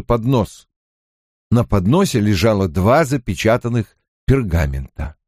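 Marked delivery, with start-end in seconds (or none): none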